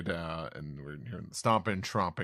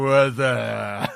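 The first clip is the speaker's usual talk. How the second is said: louder startled gasp; surprised; 4 semitones higher, 13 dB louder than usual. Acted. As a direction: blurt out in shock. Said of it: "Usopp just going".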